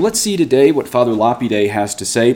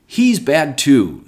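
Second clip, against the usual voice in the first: higher, slow, mocking judgment of a scornful Pharisee; imitating the Pharisees, slow and higher; mock Pharisee verdict; 5 semitones higher, 3 dB louder, much slower than usual. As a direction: drawl it out like a smug accuser.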